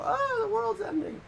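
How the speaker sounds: mock wail, very high; exaggerated kid meltdown in a squeaky voice; freaked-out toddler. Friend imitating a toddler reacting to panicking adults, mock freak-out. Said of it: "if you freak out, they go".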